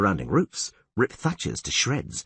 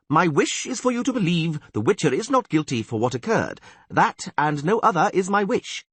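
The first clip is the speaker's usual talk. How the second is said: quoting the sorceress; 7 semitones higher, 4 dB louder than usual. Retold or imitated. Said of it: "I quote".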